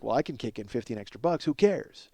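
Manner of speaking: ordinary delivery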